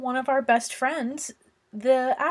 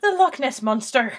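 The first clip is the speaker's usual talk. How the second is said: put-on Scottish accent, leaning Irish, through a laugh; mock Scottish accent; 2 semitones higher, 3 dB louder than usual. Reading about Nessie for the co-host, mock Scottish accent. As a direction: read it in a playful, faked Scottish brogue. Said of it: "read this in a Scottish accent".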